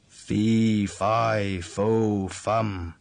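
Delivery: a storyteller's ogre calling out Fee, fi, fo, fum; ogre crying out. Acted. Ogre announces his arrival to his wife, a lightly voiced loud chant. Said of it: "The ogre cried out".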